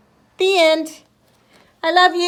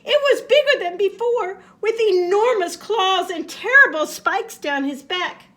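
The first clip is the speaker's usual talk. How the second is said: excited; 6 semitones higher, 6 dB louder than usual. A narrator's reading. Scared description of monster.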